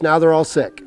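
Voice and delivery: ordinary delivery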